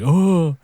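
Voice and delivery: loud, high "ohh"; exaggerated reaction "oh". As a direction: play up a big "oh" for a reaction. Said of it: "trying to get them to, like, react".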